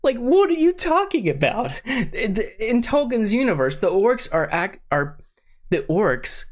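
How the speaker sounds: high-pitched, incredulous ranting; worked up. Real, not acted. Fan objecting to the show's orc family, worked up. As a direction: get carried away in a high-pitched rant. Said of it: "I'm getting worked up".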